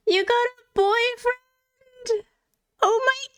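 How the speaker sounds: high, slow, teary squeal of joy; excited squeal. Real, not acted. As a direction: overcome with delight, almost crying. Streamer reacts to chat's big news.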